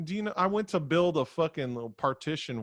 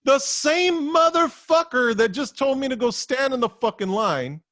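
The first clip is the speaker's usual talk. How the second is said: loud, high, heated rant; real exasperated shouting rant; 9 semitones higher, 7 dB louder than usual. Real, not acted. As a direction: blow up about the same guy. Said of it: "out of breath from ranting".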